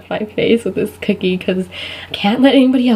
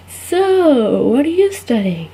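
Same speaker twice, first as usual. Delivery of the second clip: guilty asker; 5 semitones higher, much slower than usual. Retold or imitated. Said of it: "these people that feel really guilty".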